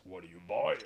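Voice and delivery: sly, mysterious shopkeeper voice; merchant voice. Retold or imitated. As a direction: lean in like a sly trader. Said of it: "The old merchant voice".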